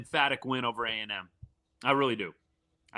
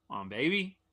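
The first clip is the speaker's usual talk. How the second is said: ordinary talk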